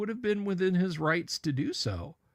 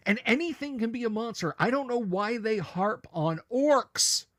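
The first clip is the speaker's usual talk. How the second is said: getting angry; 7 semitones higher than usual. Real, not acted. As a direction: vent about the orcs, getting more and more worked up. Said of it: "You got angry".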